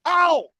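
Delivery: short high "ow"; yelp of pain. Acted. Man attacked by his puppet, pained yelp. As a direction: cry out as if just hit. Acting out being killed by puppet.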